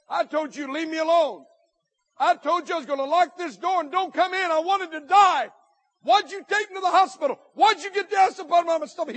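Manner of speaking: mock-furious at being saved; retelling his angry shout at the friend; angry outburst.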